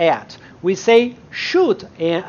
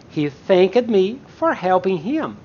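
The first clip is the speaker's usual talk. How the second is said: normal talking voice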